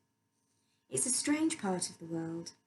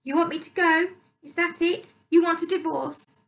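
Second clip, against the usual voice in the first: angry stepmother; 9 semitones higher, 9 dB louder than usual. A narrator's reading. Louise's voice in the quarrel.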